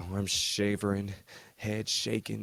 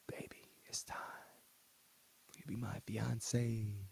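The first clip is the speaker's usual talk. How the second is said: tender whisper; 3 semitones higher, 10 dB quieter than usual. Retold or imitated. Hernandez proposing to Cheyenne, tender hushed whisper. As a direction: breathe it gently like a proposal.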